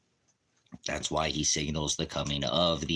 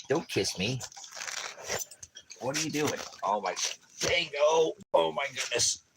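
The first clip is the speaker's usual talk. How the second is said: pet talk; 10 semitones higher than usual. Real, not acted. Dogs climbing on him.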